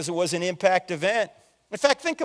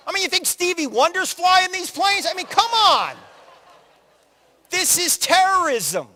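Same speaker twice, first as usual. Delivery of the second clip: higher, louder indignant rant at the TV; exasperated rant; 11 semitones higher, 5 dB louder than usual. Retold or imitated. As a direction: scold the newsman like he's a fool. Yelling at the TV commentator.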